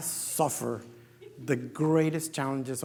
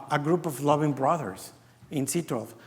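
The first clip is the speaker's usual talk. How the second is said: ordinary talk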